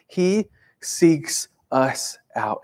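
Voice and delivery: "He seeks us out" drawn out very slowly, each word set apart; slowed-down emphatic restatement of "He seeks us out"; slow, weighty repeat. Shown as a demonstration. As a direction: let "He seeks us out" land slowly and heavily. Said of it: "repeats the line slower".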